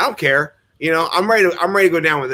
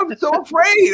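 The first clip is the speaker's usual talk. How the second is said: mock fear; 14 semitones higher, 4 dB louder than usual.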